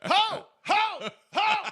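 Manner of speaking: high, loud ho ho; mock angry shout. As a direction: bellow it like an angry homeowner.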